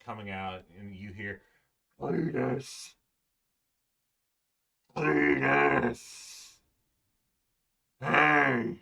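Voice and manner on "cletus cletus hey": calls of a name building to a loud shout; golem calling out. Acted. Golem hailing his long-lost friend, building to a shout. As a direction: hail an old friend louder each time.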